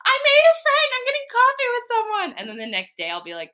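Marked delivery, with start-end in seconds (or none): 0.1-2.4 excited. Pretend. Imagining her excited call.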